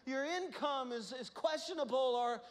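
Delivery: loud, high sermon climax; fiery preaching. Real, not acted. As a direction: preach it with full force. Preaching at full force.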